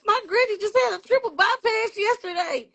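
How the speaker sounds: high-pitched mock wail; retold tearful outburst. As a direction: imitate blurting it out through tears. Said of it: "I just bust out crying".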